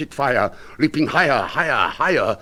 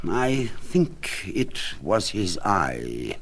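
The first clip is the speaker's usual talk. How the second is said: slow sinister confession; 2 semitones lower, 4 dB quieter, much slower than usual. Acted. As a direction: savour the reveal.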